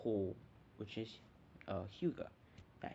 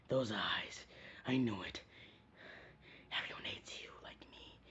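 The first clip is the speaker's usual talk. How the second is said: hushed, breathy whisper; eerie whisper; 3 dB quieter than usual. Retold or imitated. Gaara recognizing Naruto as a fellow outcast, whispered. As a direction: whisper it like a dark secret. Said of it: "Gaara's kinda like".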